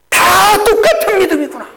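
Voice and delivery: high, loud preaching cry; preaching at full force. Acted. Pastor to congregation, crying out in full preaching force. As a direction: lift the congregation with a ringing cry. Preacher shouting his climax.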